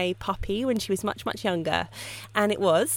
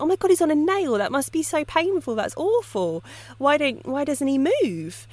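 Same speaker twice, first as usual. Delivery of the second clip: alarmed disbelief; 7 semitones higher than usual. Retold or imitated. Businessman appalled the dog sits on a nail, higher shocked voice. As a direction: sound appalled that the dog just sits there. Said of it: "the businessman's like".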